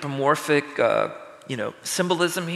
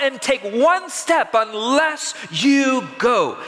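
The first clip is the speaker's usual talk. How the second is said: raised, slow, impassioned plea that swells higher and louder; Moses pleading; 7 semitones higher, 3 dB louder than usual. Acted. Moses begs God for the people, rising to a shout. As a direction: beg God not to leave, rising to a shout. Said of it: "Moses replies for the people".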